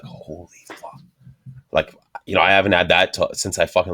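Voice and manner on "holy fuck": hushed, blissed-out whisper; sensual whisper. Retold or imitated. Cherishing leftover Italian food, sensual mock whisper. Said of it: "it was all sensual like that".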